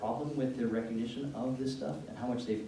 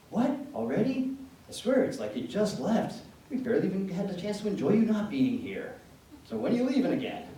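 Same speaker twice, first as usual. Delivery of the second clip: loud, higher teasing complaint; mock exasperated dad; 7 semitones higher, 5 dB louder than usual. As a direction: play a joking dad pretending to be put out. Voices dad's teasing greeting.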